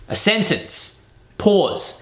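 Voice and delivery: "A sentence", a clear pause, then "Pause"; deliberate pauses. Shown as a demonstration. Speaker coach showing how to pause in a speech, deliberate pauses. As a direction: set "A sentence" apart, pause, then "Pause" alone. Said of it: "you wanna pause".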